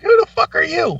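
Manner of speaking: high, loud incredulous yell; mock outraged shout. Mocking his dancing friend with a high outraged yell. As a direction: blurt it at a stranger in shock. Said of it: "It's like".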